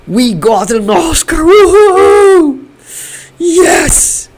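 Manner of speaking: climbing into a high, loud whoop; jubilant cheer. Real, not acted. Streamer celebrating the Oscar with viewers, jubilant. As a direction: announce it, then burst into whooping.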